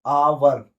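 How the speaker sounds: आवर said with a silent h, starting on the vowel आ; teacher stresses आवर, said loud and with no h; correct pronunciation. Shown as a demonstration. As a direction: stress आवर loudly with no h sound. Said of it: "आवर बोलते हैं".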